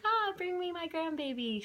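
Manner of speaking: high, gushing grandma coo; doting grandma voice. Retold or imitated. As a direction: gush like a grandmother begging for the baby. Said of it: "She's like".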